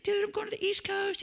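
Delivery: very high, put-on dude voice; imitating friendly banter. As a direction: answer a pal in a mock high voice. Acts out chat with friend.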